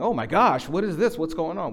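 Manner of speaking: hammed-up surprised outcry; mock alarm. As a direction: act out startled panic you didn't feel. Acting the surprise they did not feel.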